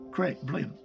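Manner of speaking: ordinary delivery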